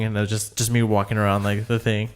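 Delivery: ordinary talk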